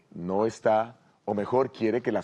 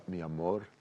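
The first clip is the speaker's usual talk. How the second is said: much softer, tender question in her ear; tender whisper-soft; 5 semitones lower, 7 dB quieter than usual. Acted. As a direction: murmur it lovingly into her ear. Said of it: "le dice al oído con ternura". (muy suave y tierno, al oído)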